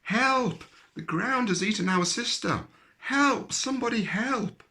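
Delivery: high, loud panicked cries; little rabbits screaming for help down the hole; wailing for help. A narrator's reading. Frightened rabbits wailing for help. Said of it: "Then they began to wail".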